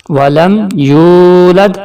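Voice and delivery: یولد with the و held long; stretched vowel. Shown as a demonstration. Teacher showing the stretched واو. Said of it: "کھینچ کے پڑھا جائے گا".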